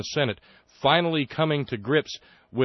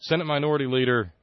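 ordinary talk

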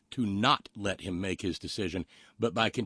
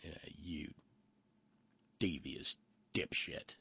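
very low, hushed insult; soft, low, contemptuous aside; muttered contempt; 6 semitones lower, 12 dB quieter, slower than usual. Real, not acted. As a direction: mutter it under your breath. Deflated insult after the rant.

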